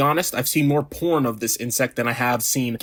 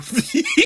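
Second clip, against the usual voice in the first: squealed giggle, far above normal pitch; short helpless high laugh; real squeaky burst of laughter. Real, not acted. Cracks up at the name.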